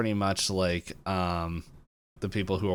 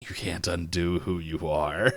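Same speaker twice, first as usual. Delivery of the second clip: hammed-up menacing pronouncement, rising at the end; mock ominous woman; 3 semitones lower than usual. Woman's ominous reply, acted out.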